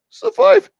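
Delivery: very high-pitched put-on voice; A light impression. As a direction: squeak it out, trying for the impression. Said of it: "I forgot how to do the A light".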